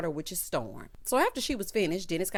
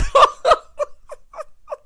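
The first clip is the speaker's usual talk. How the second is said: loud, high burst of real laughter; shrieking laugh cutting off the sentence; cackle; 12 dB louder than usual. Real, not acted. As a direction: explode into laughter mid-word. Cracks up again.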